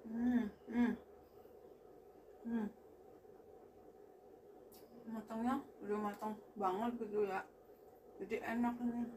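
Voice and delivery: speaking between bites; talking while chewing. Real, not acted. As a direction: mouth partly full of egg. Seen 1: Bit egg at -7; lips closed, cheeks working, chewing throughout.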